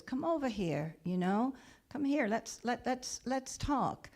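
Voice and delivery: put-on high, soft coaxing old man; old man gently inviting a drunk to talk; gentle coaxing. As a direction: coax him over softly, like calming a wild animal.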